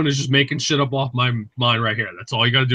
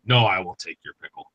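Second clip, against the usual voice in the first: mock solemn refusal; 8 semitones lower than usual. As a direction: decline the swap with fake gravity. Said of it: "I'm like".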